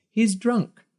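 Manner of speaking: put-on high, sniffy old woman; prim old biddy. A narrator's reading. An old biddy disapproving of Peter falling, sniffy high sneer. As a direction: sneer in disgust.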